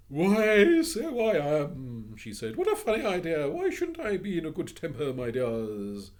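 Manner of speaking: high, loud cheerful matron; jolly old lady. Acted. Missus Biddle greeting the children, jolly. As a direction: beam with sudden good humour.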